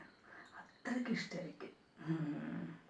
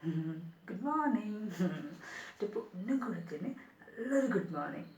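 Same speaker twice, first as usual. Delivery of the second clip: put-on affectionate baby talk, higher and louder; cooing baby talk; 3 semitones higher, 3 dB louder than usual. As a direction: sweet-talk a toddler awake, lilting and affectionate. Cooing baby talk to little girl.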